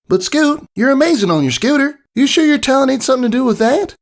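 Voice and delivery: high Southern filly twang, quick; twangy kid cheering her friend on; country filly voice. A narrator's reading. Apple Bloom answers her friend.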